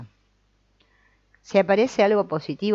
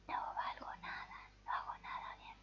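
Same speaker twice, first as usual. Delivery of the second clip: whisper; 21 dB quieter than usual. Shown as a demonstration. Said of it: "en voz muy baja o muy alta".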